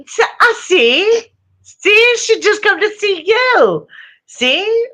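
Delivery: high sing-song voice for the cat; pet talk. Real, not acted. Owner cooing to her cat on camera, high pet talk. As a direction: coo at the kitty watching the stream.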